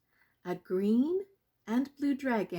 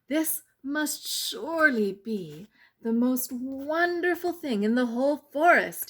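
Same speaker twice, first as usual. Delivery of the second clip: louder and higher, swelling with wonder; excited cry; 5 semitones higher, 6 dB louder than usual. A narrator's reading. Prince gasps at the pie-making snozzle, loud and amazed. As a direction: let the wonder rise to a cry. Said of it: "he cried".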